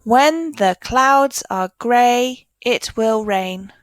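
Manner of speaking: slow, steady sentence reading; reading again slowly. Shown as a demonstration. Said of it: "Let's read it again".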